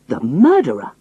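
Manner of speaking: a mild frightened cry. Acted. Frightened Jopley recognises Hannay, mild cry. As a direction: cry out in alarm. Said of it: "he cried".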